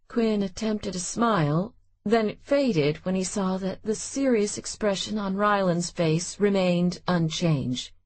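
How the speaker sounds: ordinary delivery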